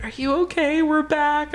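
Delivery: high-pitched imitation of fussing friends; concerned friends. Friends hugging her awake after the ride, high concerned voice. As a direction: put on a high, cooing check-in.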